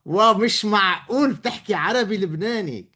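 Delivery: high, fast excited Lebanese Arabic; thrilled Levantine Arabic; Lebanese Arabic accent. Real, not acted. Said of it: "your accent's so much cooler".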